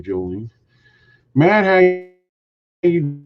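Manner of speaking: ordinary delivery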